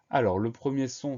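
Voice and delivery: usual speaking voice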